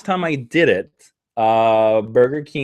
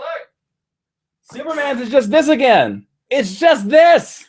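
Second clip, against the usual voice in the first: excited; 11 semitones higher than usual. Real, not acted. Showing off shirt emblem.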